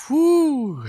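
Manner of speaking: high, long mock "whoo"; drawn-out whoo. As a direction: let out a high-pitched exaggerated whoo. Mock reaction to being hit.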